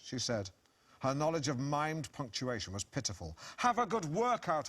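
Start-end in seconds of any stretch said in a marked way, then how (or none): 3.6-4.7 innuendo. Retold or imitated. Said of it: "slotting my right forefinger in and out".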